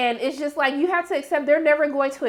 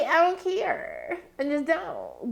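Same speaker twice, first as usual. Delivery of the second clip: high, drawn-out "care", fading softer; exaggerated indifference; 3 semitones higher, 5 dB quieter, much slower than usual. Viewer unmoved by the father storyline, mock indifference. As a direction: drag it out in a dismissive singsong, then drop the volume.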